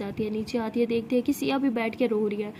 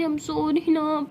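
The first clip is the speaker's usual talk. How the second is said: slow, drawn-out coaxing sorry; pleading apology; 3 semitones higher, much slower than usual. Acted. Siya coaxing upset Tara to forgive her, pleading. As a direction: plead by stretching out the last word.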